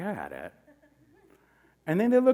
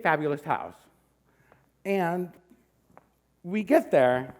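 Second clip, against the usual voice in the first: ordinary delivery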